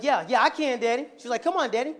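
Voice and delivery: high, loud imitation of an eager child; Phoenix. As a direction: plead brightly like a daughter urging Daddy on. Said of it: "Phoenix was like".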